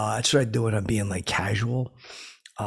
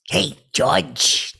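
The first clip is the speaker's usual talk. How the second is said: higher, louder creepy clown call; Pennywise; 4 semitones higher, 7 dB louder than usual. Acted. Demonstrating his audition for the author, Pennywise voice. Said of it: "I would be so good as Pennywise".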